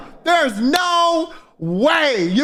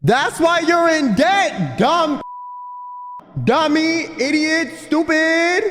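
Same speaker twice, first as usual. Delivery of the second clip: mock yell; 5 semitones higher, much slower than usual. Real, not acted. Cohost mock-yells insults at guest.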